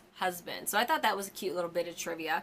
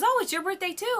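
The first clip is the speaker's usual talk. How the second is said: higher, slowed, lightly voiced cheerful clerk; cheery shop clerk; 11 semitones higher, 5 dB louder than usual.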